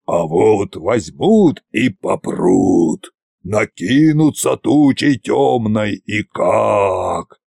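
put-on slow, spooky old tsar voice; ominous warning. A narrator's reading. Tsar frightens servant with invading gods, slow and ominous. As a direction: draw it out like a scary tale.

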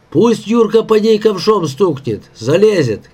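high-pitched, restrained shout; shouted suggestion. A narrator's reading. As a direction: call the idea across to the others. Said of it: "крикнул Васька". (крикнуть повыше, без надрыва)

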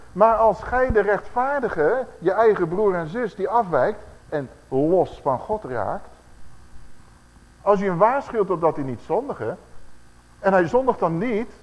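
slow, loud scripture proclamation; forceful preaching. Acted. Preacher reading verse twenty-one aloud, slow and loud. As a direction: hammer the verse home to the church.

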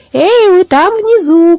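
high, slow shout from the rooftop; raven calling down. A narrator's reading. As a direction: call down to the crowd below, drawn out. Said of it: "крикнул Абрахас".

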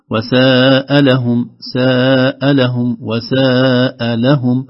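وساء لهم very slow, long "saaa", then "lahum" separate; words kept apart. Shown as a demonstration. Teacher repeating the example for the host, drawn-out وساء لهم. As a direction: stretch ساء and set لهم apart. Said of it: "من السوء وليس من المساء".

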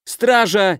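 high yell for the guards. A narrator's reading. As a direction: scream for the guard across the square. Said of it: "секретарь Отмира завопил на всю площадь".

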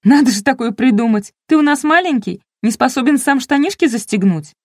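high, teasing scolding; mock him like a small child; sarcastic, talking down to a toddler. A narrator's reading. Mocking scolding of drunk friend.

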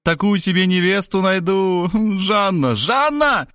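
high, excited mother; rapturous high-voiced calling; delighted cry. A narrator's reading. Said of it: "восторженно закричала она".

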